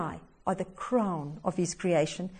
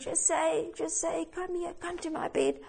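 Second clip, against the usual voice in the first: faint, softer plea from a sickbed, slightly higher; barely able to call, soft and weak; 5 semitones higher, 9 dB quieter than usual. A narrator's reading. Dying mother calls her son to her bed, weak and soft. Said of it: "in a weak voice said".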